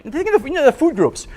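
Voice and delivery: normal talking voice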